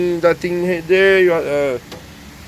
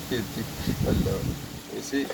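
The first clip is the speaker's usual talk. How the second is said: hushed; 7 semitones lower, 10 dB quieter than usual. Real, not acted. Hushed delight.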